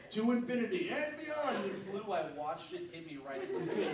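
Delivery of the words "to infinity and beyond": high, loud toy hero catchphrase; imitating a talking toy's battle cry; Buzz Lightyear. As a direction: proclaim it like a heroic action figure. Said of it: "Buzz Lightyear was like".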